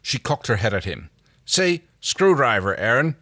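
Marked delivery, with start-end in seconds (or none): 1.4-3.1 slow, raised, coaxing plea; pleading. Acted. John begs Erin to say the word, pleading. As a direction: coax her, drawing the words out. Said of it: "John pleaded".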